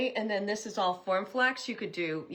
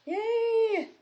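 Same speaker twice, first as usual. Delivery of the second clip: high, bright yay; cheer; 3 dB louder than usual. Real, not acted. Crafter cheering her viewers at the end, high and happy. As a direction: cheer the finish.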